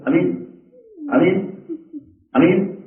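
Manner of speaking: امین short and quick, rising at the end. Retold or imitated. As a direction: say امین with a short first vowel, each one separate. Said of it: "کوئی دعا کرتا ہے تو کہتے ہیں".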